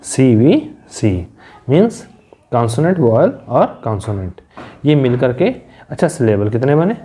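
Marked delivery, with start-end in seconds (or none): 0.0-1.3 C, V, C drawn out one letter at a time; letter-by-letter teacher spelling; spelled out slowly. Shown as a demonstration. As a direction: spell the pattern slowly for students. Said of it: "इसका pattern जो बना है".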